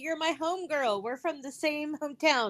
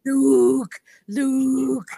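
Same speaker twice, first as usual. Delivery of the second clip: put-on Tommy Boy quote; 11 dB louder than usual. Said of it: "Like Tommy Boy".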